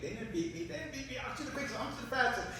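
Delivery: high-pitched, protesting rabbit voice; acted high squeal of denial; indignant rabbit. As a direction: insist in a high, wounded boast. Rabbit protests at being mocked.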